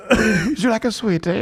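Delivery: mock soft-spoken posh offer, laughing; a gentle offer, half laughing. Mimicking the boxer's soft-spoken voice, laughing. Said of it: "that soft-spoken".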